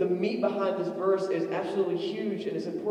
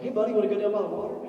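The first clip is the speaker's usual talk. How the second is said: fast, casual invitation; cheerful dad to his boy; breezy dad; 4 semitones higher, much faster than usual. Retold or imitated. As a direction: toss it off quickly to your son. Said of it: "so I'm like".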